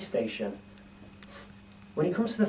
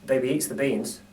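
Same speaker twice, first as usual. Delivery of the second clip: ordinary delivery, nothing marked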